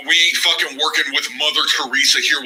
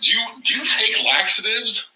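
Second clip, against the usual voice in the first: much higher, hammed-up joking host with laughs; comic host voice; 11 semitones higher than usual. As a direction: play a silly late-night host cracking jokes. Comic host bit in put-on voice.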